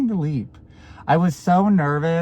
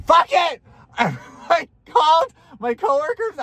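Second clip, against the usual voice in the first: excited; 13 semitones higher, 4 dB louder than usual. Real, not acted. Excited retelling of decision.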